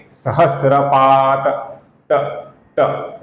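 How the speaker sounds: सहस्रपात ending in a half त, then the त alone; half final t. Shown as a demonstration. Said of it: "ऐसा उच्चारण हम करते हैं". (आधा त के साथ सहस्रपात)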